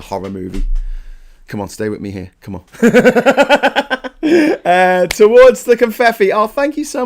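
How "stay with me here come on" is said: ordinary delivery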